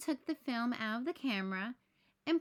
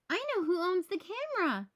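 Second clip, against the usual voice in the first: higher, louder excited cry; excited shout; 7 semitones higher, 3 dB louder than usual. A narrator's reading. Franklin finding the owner, excited shout. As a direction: announce the discovery with delight. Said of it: "he shouted".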